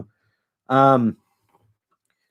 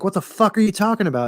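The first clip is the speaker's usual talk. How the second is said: high, fast, baffled disbelief; quick pitched-up what-the-hell reaction; incredulous outburst; 10 semitones higher, faster than usual. Retold or imitated. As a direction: blurt it out, can't believe what he's hearing. Hobgoblin reacts to a wild plan.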